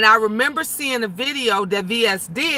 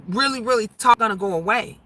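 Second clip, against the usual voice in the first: ordinary talk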